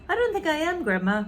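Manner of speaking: young George, lightly voiced; polite boy. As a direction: answer sweetly like a good little boy. George's voice in story.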